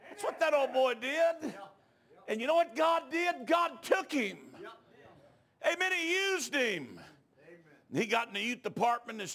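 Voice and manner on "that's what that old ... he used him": high, loud, slow shouted preaching; preaching at full force. Acted. Preacher urging the congregation to answer, in full shouted fervour. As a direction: build the altar call to a roar.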